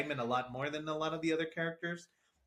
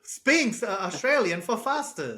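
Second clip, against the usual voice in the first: lightly mocked Australian ad slogan; Australian accent; 5 semitones higher, 5 dB louder than usual. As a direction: play the slogan up in a higher Australian voice. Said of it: "Very Australian accent on this Sphinx".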